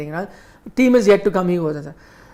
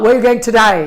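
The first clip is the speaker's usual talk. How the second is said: put-on Australian accent; 3 semitones higher, 5 dB louder, faster than usual. Taxi driver asking Kapil Dev his destination, Australian accent. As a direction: say "die" the Australian way for "day".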